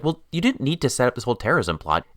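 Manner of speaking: ordinary delivery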